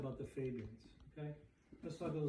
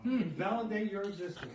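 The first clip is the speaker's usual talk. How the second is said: loud, raised imitation British accent; mock British accent; 4 semitones higher, 9 dB louder than usual. Quoting Shaw's demand to the board, mock British accent. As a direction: push it out loudly as an imitated Briton. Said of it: "he says in his British accent".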